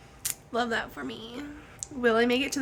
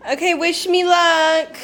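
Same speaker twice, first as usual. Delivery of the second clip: excited; 7 semitones higher, 8 dB louder, slower than usual. Real, not acted. Leaving for interview.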